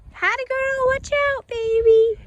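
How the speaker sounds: high coaxing pet voice; talking to animal. Real, not acted. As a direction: sweet-talk the horse. Coaxing the horse.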